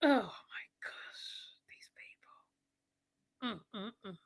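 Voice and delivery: whispered oh my gosh; whispered disbelief. Real, not acted. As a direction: breathe it out in dismay. Reacting quietly to the people.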